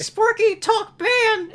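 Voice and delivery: a Simpsons character scolding, in a very high voice; cartoon character voice. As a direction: pitch it way up and bark it out. Character voiced in retold episode.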